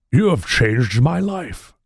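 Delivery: solemn theatrical impression, drawn out with long weight; Picard. Shown as a demonstration. Father to his newfound son, slow solemn captain impression. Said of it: "See if I can do the impression".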